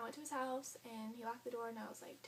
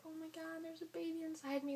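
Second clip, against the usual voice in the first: higher, awestruck; awed wonder; 5 semitones higher than usual. Retold or imitated. Mother-to-be hearing the heartbeat, awed. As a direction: marvel at it.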